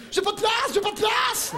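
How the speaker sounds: high, loud complaining shout; hammed-up cramped passenger yelling; mock yell. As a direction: yell from the back row about no room. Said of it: "l'autre en arrière, il crie".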